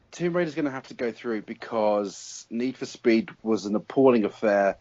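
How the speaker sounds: ordinary talk, nothing marked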